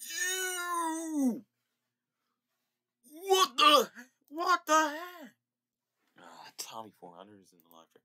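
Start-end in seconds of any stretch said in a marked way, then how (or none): 0.1-1.4 very high grossed-out cry of ew; disgusted squeal. Real, not acted. Disgusted by sprite. 3.3-5.3 high, louder disbelief; stretched-out shocked outburst. Real, not acted. Shocked by sprite.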